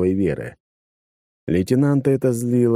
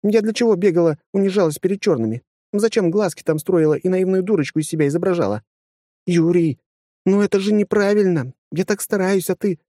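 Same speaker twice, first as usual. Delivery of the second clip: higher, hurt woman's voice; indignant young woman; 11 semitones higher than usual. A narrator's reading. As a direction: protest like a wronged girl. Indignant female character.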